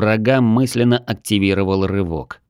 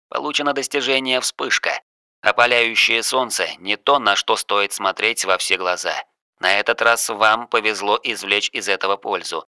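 softer, detached system announcement; system message voice; 3 dB quieter than usual. Acted. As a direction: read it like a game notification. System notification read out.

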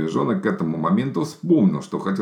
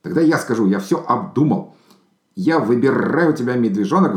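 blurted out; 6 semitones higher, 4 dB louder than usual. A narrator's reading. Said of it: "выпалил".

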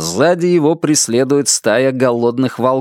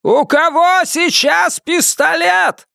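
yelling over the radio; slower than usual. A narrator's reading. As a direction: bellow the question over the crackle. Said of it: "проорал Ван Аллен".

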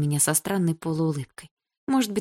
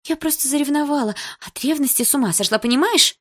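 much higher, rushed insincere pleading; pleading girl; 6 semitones higher, 3 dB louder than usual. A narrator's reading. Lika begging Polina's forgiveness, high and pleading. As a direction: grab her hands and beg, overdoing it.

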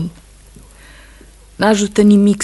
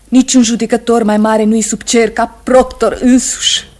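louder, higher fervent appeal; fervent cry; 5 semitones higher, 8 dB louder than usual. Acted. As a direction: cry it out to him with conviction. Wife urges him fiercely.

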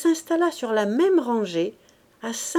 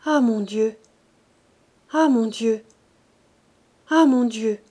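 louder, stunned repetition; same tone repeated; 5 dB louder than usual. Acted. Gervaise hears her man has left, stunned repeating. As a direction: say it again and again unchanged. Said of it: "Elle le répéta vingt fois sur le même ton".

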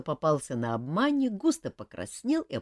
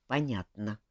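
plain reading voice

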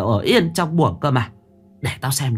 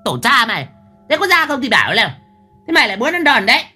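glaring threat; 13 semitones higher, faster than usual. A narrator's reading. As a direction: threaten the maid harshly. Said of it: "Mụ trợn mắt".

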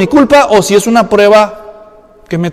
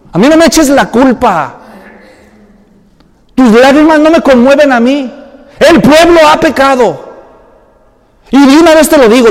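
raised, scolding voice of God; God rebuking; 4 semitones higher, 4 dB louder than usual. Retold or imitated. God scolding Joshua for his tears, raised stern rebuke. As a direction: scold a whining leader from above. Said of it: "Le dice en el versículo diez".

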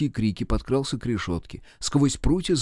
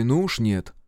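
ordinary delivery

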